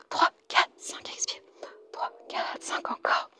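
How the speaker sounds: very soft, whispered exercise beats; whispered count. Real, not acted. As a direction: count on the breath. Counting breaths during the exercise.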